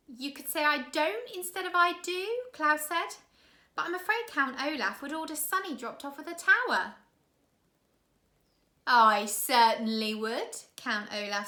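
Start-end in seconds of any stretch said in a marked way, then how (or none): 0.1-7.0 put-on much higher, louder boy; a puzzled young boy; curious boy. Klaus asking his sister about bombs, high and curious. 8.8-10.5 loud, slow villain voice; sinister villain. Acted. Count Olaf surprising the orphans, slow, loud and sinister.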